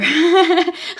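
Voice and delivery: a sudden loud laugh bursting out; loud burst of laughter. Real, not acted. Reader laughs at her own tarot pile, bursting into loud laughter. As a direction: crack up out loud, high. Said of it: "I'm laughing because I".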